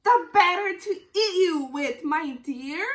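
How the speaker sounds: loud snarling threat. Acted. Wolf snarling at Little Red before he pounces. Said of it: "snarled the wolf".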